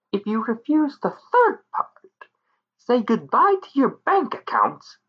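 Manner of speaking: high menacing fairy voice; sweetly threatening pixie. Acted.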